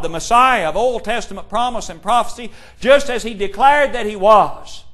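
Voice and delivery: climbing to loud, fervent proclamation; building preaching. Acted. Preacher driving home that Jesus was the Christ, rising to full force. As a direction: build it up until it rings out.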